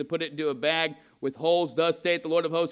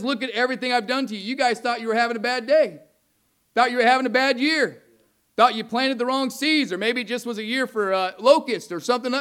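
raised, louder, fast reproach; angry God; 6 semitones higher, 4 dB louder than usual. Retold or imitated. God confronting the people over their bad year, angry and loud. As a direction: rail at them as an angry God listing punishments. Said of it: "how mad he is".

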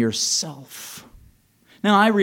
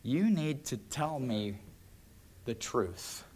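much softer, slow, grave; God speaking gently; 2 semitones lower, 10 dB quieter, slower than usual. Acted. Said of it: "God is saying".

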